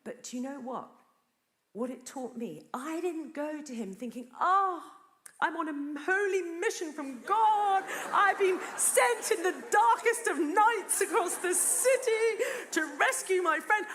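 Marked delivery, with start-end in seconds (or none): 4.4-13.8 high, loud grandiose proclamation; hammed-up holy crusader on a mission; mock-heroic. Preacher mocking her imagined heroic thoughts, mock-heroic.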